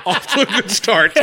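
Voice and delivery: lightly put-on pleasantness; sardonic aside. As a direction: remark drily through the laughter. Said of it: "I'm like".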